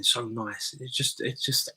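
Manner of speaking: ordinary delivery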